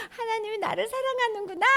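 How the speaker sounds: high, smiling voice; happy realization. Acted. Child hearing God loves them, smiling. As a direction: say it with a smile. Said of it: "이렇게".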